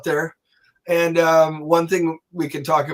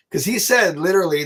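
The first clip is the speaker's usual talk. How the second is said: ordinary talk, nothing marked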